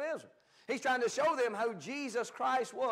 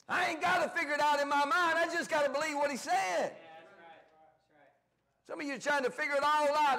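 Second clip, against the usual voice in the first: preaching at full force; 5 semitones higher than usual. Acted. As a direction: drive it home to the congregation.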